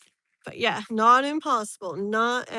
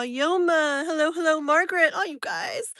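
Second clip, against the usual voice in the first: excited greeting; 6 semitones higher, 4 dB louder than usual. Real, not acted. Greeting chat viewers by name.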